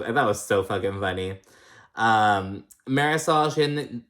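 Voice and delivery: ordinary delivery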